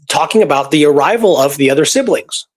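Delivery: ordinary talk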